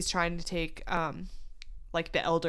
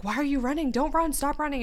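raised, pleading call to stop her; a man calling out, raised and urgent, to a running woman; 6 semitones higher than usual. Retold or imitated. Captain Ri calls to running Seti.

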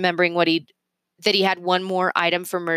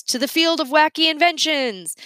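cheerful toast; 9 semitones higher than usual. Acted. Group toast.

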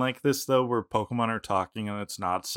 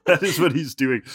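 ordinary talk

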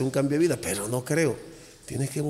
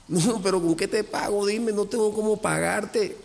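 raised, pleading voice of a thankful client; mock-pleading, higher voice; grateful client pleading; 8 semitones higher than usual. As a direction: beg him to take the payment, pitched up. Grateful client begging to pay.

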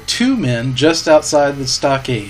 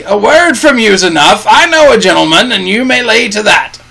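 loud, high, hearty holler; Long John Silver's cheery shout; 7 semitones higher, 6 dB louder than usual. A narrator's reading. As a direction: shout your agreement cheerfully. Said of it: "shouted Long John cheerily".

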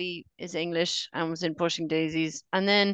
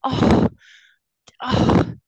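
loud gasping disbelief; higher and louder, caught off guard; shocked surprise; 9 semitones higher, 10 dB louder, much slower than usual. Real, not acted. S0 learns Lee Pace is American.

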